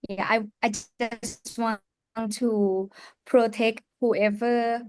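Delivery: normal talking voice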